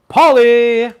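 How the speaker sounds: loud, high call across the room. Real, not acted. Host calling out to his friend Paul as he arrives. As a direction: shout a friend's name to greet him.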